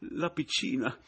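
high, panicky, halting worry; timid man's fearful pleading; frightened stammer. A narrator's reading. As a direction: falter anxiously through it. Pomino frightened, still pleading.

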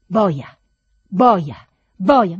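loud, punched, repeated cries; shouted insult. A narrator's reading. Master hurls the insult at departing servant, shouting. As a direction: hammer out the insult in separate shouts. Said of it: "le mie grida di richiamo".

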